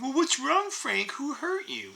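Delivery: put-on high, soft, babying voice; mock concern. Frank mimics a critic asking who hurt him, mock concern.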